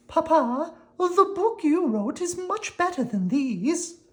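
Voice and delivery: put-on a child earnestly praising her papa's book; young daughter. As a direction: daughter telling her father his book is better. Daughter Kate speaks to father.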